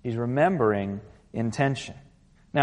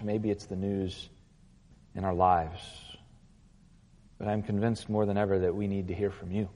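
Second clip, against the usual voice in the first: soft, low prayer voice; 4 semitones lower, 3 dB quieter than usual. Acted. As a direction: pray quietly before the sermon. Pastor prays before sermon.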